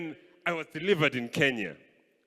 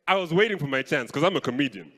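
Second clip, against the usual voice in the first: usual speaking voice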